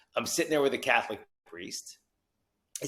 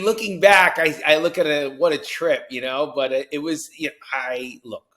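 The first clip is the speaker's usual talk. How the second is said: normal talking voice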